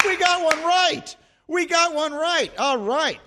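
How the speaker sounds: high, thrilled celebration after a correct guess; excited cheer. Real, not acted. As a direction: cheer like you finally won.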